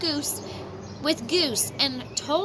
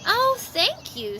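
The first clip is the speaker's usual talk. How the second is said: delighted thanks; 9 semitones higher, slower than usual. Acted. Franklin delighted at yes.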